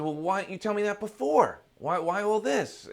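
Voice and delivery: loud, high, indignant questioning; exasperated protest. Acted. Seeker demands to know why the sage waited, exasperated.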